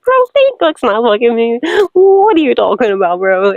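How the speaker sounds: loud, incredulous "bro"; woman scoffing loudly at her jealous ex; exasperated outburst. Retold or imitated. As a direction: push back loud in disbelief. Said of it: "I was like".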